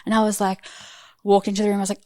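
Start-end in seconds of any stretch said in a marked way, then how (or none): none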